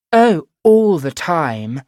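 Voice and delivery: put-on slow, high, loud plummy English posh accent. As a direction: put on a grand upper-class English accent. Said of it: "putting on my posh voice".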